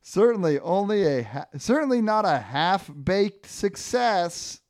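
punning pepperoni Ernie. Acted. Ernie sums up the pizza adventure, hammed-up. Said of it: "And then Ernie goes".

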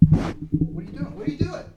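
frantic high-pitched yelling; mock panic, voice jumping up; panicked quote. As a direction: shriek it like someone losing it. Mimicking someone going nuts.

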